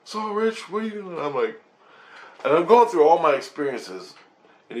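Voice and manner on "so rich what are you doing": gentle guide. Retold or imitated. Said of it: "He goes".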